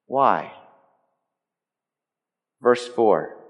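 normal talking voice

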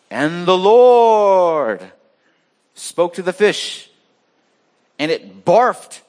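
slow, booming, drawn-out proclamation; grand scripture reading. Acted. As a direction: read the verse like a solemn epic, then land on barfed. Dramatic reading of scripture verse.

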